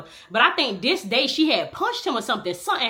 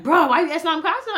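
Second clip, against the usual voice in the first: put-on higher, louder teen protest; kids annoyed; 8 semitones higher, 4 dB louder than usual. Kids complaining to their mom, higher pitched. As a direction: push back like an annoyed teen. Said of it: "They be like".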